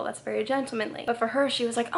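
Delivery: usual speaking voice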